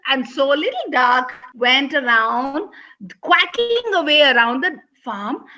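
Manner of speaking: ordinary delivery, nothing marked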